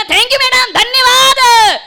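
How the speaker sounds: hammed-up effusive thank you; gushing thanks. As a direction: overdo the gratitude to a stranger. Gushing thanks to stranger woman.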